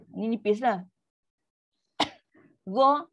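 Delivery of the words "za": "Za" with the back of the tongue raised; heavy, rounded "Za"; high thick za. Shown as a demonstration. Teacher isolates the high letter for students, thick za. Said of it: "istilah tinggi".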